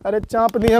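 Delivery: normal talking voice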